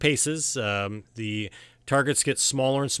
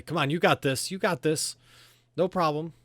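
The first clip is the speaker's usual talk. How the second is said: higher-pitched supporter cheering from the crowd; encouraging cheer; 7 semitones higher than usual. Acted. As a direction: urge your friend on. Cora cheers on the archer.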